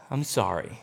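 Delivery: sigh, then a low, soft apology; reluctant kid. Acted. Kid giving in to parent, reluctant. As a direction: insincere apology in a deflated low voice. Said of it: "And so they'll go".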